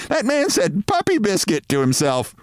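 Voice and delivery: woman mocking to her friend; amused passer-by. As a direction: repeat it with a scornful giggle. Woman mocks Mitty to companion.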